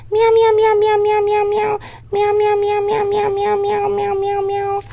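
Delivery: very high squeaky kitten meows; cat meows. Retold or imitated. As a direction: mew like a tiny kitten sound machine. Sound machine imitation, cat meows.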